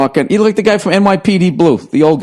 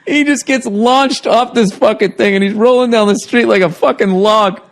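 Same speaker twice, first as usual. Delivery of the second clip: high, laugh-filled telling; can barely get it out for laughing; laughing hard; 8 semitones higher than usual. Real, not acted. To a friend, recounting the crash while cracking up. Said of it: "Why are you laughing so hard?".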